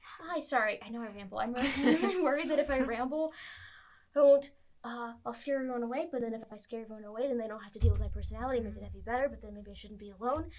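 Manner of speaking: rambling at a mile a minute; fast nervous over-talking; jittery stimulant high. Acted. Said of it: "Talking a mile a minute".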